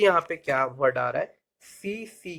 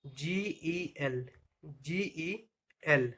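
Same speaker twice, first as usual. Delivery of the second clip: slow, letter-by-letter spelling; spelled out slowly; 11 dB quieter, much slower than usual. Shown as a demonstration. Teacher spelling gel for learners, slowly letter by letter. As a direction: draw out each letter separately.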